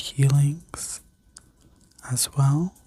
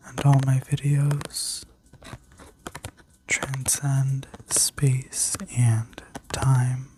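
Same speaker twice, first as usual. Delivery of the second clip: sleep story narrator. Acted. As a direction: guide them gently into rest. Guided visualization continues.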